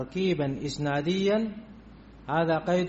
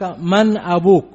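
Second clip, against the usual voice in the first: called out loud as a demo question; teacher calls out the question to the class; example question; 10 dB louder than usual. Shown as a demonstration. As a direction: ask it loudly, as if to a pupil. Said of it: "مثلًا الآن أقول لك".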